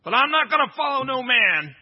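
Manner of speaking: loud, high defiant refusal; defiant wife. Retold or imitated. Preacher voicing a wife who refuses to follow, loud and high. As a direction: slam the door on it.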